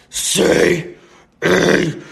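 harsh, forceful demon growl; menacing growled command, broken by pauses. Retold or imitated. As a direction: roar it slowly, word by word. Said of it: "he gets even louder".

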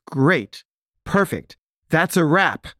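high, upbeat wrap call; pleased director. Retold or imitated. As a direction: imitate a satisfied director calling it done. Director happily calls a wrap.

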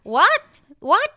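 high, loud confused "What? What?"; mock baffled woman. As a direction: act totally lost, yelping. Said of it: "dumb women who's like".

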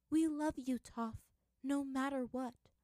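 high, slow loving voice; tender farewell. A narrator's reading. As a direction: say goodbye tenderly.

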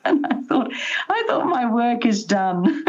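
high-pitched, laughing through the words; proud punchline squeezed out through a laugh; amused, laughing. Real, not acted. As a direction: let real laughter lift the voice right up. Grandmother laughing at her grandson's remark.

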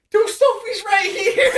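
high, loud, stretched-out mock panic; retold freak-out. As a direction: act out a frantic yell to friends. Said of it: "we're all freaking out at this point".

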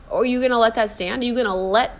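ordinary talk, nothing marked

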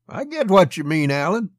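high, loud, scornful reply; disdainful. A narrator's reading. Monty answers the young officer's threat, disdainful. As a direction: throw it back with disdain. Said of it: "threw disdain at the young officer".

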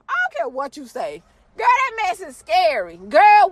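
ordinary talk, nothing marked

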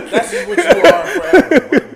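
real laughter; laughing. Real, not acted. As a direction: crack up while saying it. Laughing at her end game.

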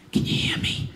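hushed secret whisper. Retold or imitated. Mom whispering on the phone from work, whispered. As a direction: whisper it like she's hiding. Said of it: "Why are you whispering?".

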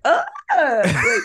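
high put-on whimper while falling; mock whimper. Imitating the girl falling, a mock whimper. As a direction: whimper like fainting limp.

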